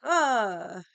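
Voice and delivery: loud exasperated "ugh"; frustrated groan. Retold or imitated. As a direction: let out a big groan of frustration. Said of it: "I was very frustrated".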